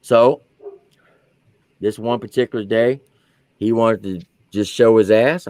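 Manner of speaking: ordinary talk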